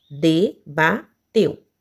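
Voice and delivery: "Debateu" very slow, de-ba-teu; long gaps between syllables of "Debateu". Shown as a demonstration. Teacher showing three syllables, "Debateu" slowly separated. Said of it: "três sílabas".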